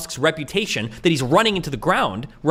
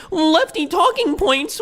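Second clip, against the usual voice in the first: much higher, slow sneering parody; a high, drawn-out mock of the friend's phrase; mocking him; 10 semitones higher, much slower than usual. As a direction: mimic his catchphrase with scorn. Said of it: "you keep running behind this, like".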